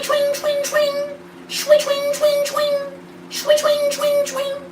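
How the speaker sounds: squeaky high whooshing swings; high swing sword noises. Pretend. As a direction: imitate a sword whooshing past.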